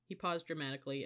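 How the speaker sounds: ordinary delivery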